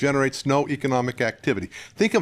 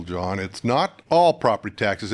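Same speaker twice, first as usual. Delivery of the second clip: usual speaking voice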